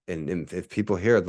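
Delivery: usual speaking voice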